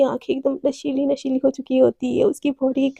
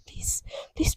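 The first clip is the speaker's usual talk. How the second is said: softer, unvoiced pleading; whispered plea; 15 dB quieter than usual. Girlfriend begging her partner in a hush. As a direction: murmur please close to her ear.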